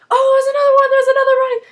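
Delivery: very high, loud alarmed yelling; mock frantic shout. Recapper playing rescuers shouting from the shipyard. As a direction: call out as if spotting a body in the water. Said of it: "these rescuers are like".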